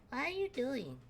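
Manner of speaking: put-on a lost kid looking around; small confused child calling out; Rad the kid. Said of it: "slowly lowers his bat and goes".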